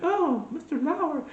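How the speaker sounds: high, slow coo in a put-on voice; mock swooning woman. Woman cooing to TV host, high mock swoon. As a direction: play a swooning admirer, way up high.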